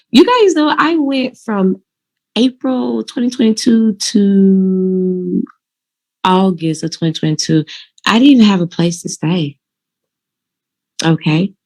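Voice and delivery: ordinary talk, nothing marked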